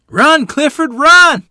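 high, loud shout; urgent shout. A narrator's reading. Children shout to Clifford to run, high and loud. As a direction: yell it out as a warning. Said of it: "they shouted".

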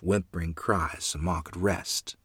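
usual speaking voice

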